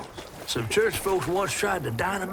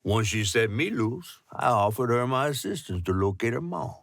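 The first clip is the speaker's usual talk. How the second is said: story narration; 5 semitones lower than usual. Acted. Recap narration of Hazel's journey.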